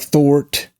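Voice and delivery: Brooklyn "thort", with an R in it; overdone Brooklyn r-coloured vowel in "thort"; overdone Brooklyn accent. Shown as a demonstration. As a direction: exaggerate a rhotic New York "thort". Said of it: "I'm overdoing it".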